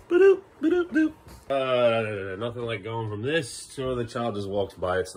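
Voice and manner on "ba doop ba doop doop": high-pitched "ba-doop" jingle; playful high boops; mock show jingle. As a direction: sound out a silly TV sting.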